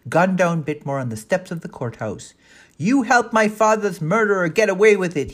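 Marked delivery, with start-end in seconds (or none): 2.8-5.3 loud accusing shout at the killer. A narrator's reading. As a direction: yell it out in rage. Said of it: "he was heard shouting".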